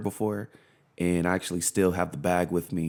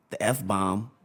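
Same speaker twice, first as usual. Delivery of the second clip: usual speaking voice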